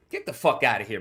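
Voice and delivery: usual speaking voice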